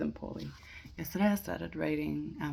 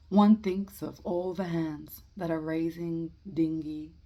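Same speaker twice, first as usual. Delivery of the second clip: measured verse reading with pauses between lines; poetry reading; 10 dB louder, slower than usual. Acted. Amateur reading Eliot's Preludes to listeners, measured poetry reading. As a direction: read each line out deliberately, then pause. Reads a poem aloud.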